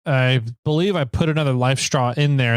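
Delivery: ordinary delivery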